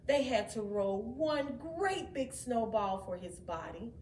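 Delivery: plain reading voice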